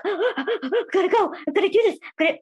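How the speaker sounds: high, breathless, laughing gung-ho rush; psyching herself up with a giggle; eager inner voice. Retold or imitated. Competitive Samantha's inner voice, high and eager. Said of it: "competitive Samantha, who's already like".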